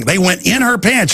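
normal talking voice

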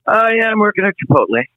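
high mock-casual answer; lightly voiced teenager; kid's reply. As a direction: toss it off like a teen.

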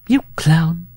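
high-pitched bride's exclamation; high, roguish sweetheart; teasing bride. A narrator's reading. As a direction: tease your lover in a high, put-on voice. Bride teasing her lover.

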